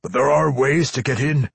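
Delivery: high, loud dwarf; dwarf vampire offering a way; Pwent. A narrator's reading. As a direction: confide it loudly in dwarf voice.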